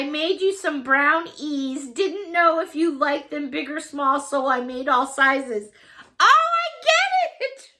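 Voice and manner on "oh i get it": high squeal breaking into laughter; delighted realization. Real, not acted. Suddenly getting Patty's brownie joke, delighted realization. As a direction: burst out laughing as the joke lands.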